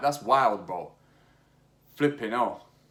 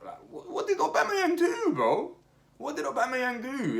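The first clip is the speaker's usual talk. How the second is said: much higher, disbelieving squeal; incredulous; 14 semitones higher than usual. Real, not acted. Vlogger baffled by what Aubameyang did, squeaky disbelief. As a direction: ask it in high-pitched disbelief.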